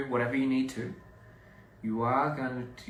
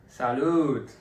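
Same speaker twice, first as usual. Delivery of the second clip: a loud toast call; louder toast; 7 dB louder than usual. Shown as a demonstration. Toasting the viewers properly, loud cheer. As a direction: belt out the toast to the camera. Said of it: "I do have to say, salute".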